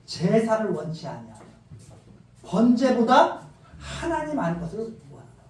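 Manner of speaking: slow, loud scripture declaration; solemn proclamation. Acted. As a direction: proclaim each phrase slowly. Quoting God, slow and emphatic. (느리고 힘찬 선포)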